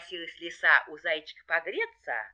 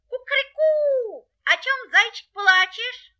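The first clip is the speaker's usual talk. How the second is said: very high, loud rooster voice; crow, then ask in the same high voice; 8 dB louder than usual. Acted. Rooster greets the weeping hare, crowing.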